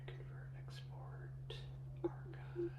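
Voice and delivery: muttered under the breath; whispering to himself. Real, not acted. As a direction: whisper the menu options to yourself. Muttering menu options to himself.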